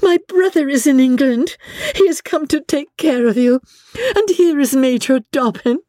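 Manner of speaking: high, bright excited announcement; Emmy telling her old father the joyous news; joyful exclaiming. A narrator's reading. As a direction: tell papa the wonderful news. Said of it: "Emmy cried".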